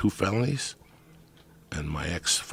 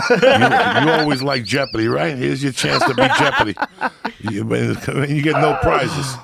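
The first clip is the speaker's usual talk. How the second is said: loud, laughing game-show tease, higher and louder; laughing taunt; 8 semitones higher, 12 dB louder than usual. Real, not acted. Teasing his host like a game show host, loud and laughing. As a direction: crack up while daring him to play Jeopardy.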